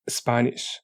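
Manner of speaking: ordinary delivery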